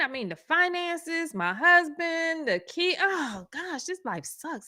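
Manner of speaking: high, exasperated complaint at God; anguished rant. Retold or imitated. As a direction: pour out every grievance to God. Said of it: "I was so mad".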